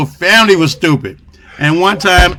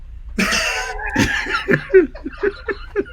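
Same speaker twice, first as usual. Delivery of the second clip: high laugh; 6 dB quieter than usual. Real, not acted. Laughs at joke.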